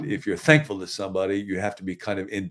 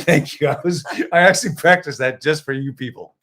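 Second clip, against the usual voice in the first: laughing; 7 semitones higher, 7 dB louder than usual. Real, not acted. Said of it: "Nice Jersey accent".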